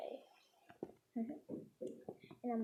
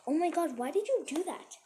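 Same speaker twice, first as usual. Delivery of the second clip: higher, mildly outraged teacher; acted shock; 6 semitones higher, 4 dB louder than usual. Teacher to mean girl about cut hair, acted shock. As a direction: scold in mock horror.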